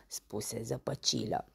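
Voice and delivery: plain reading voice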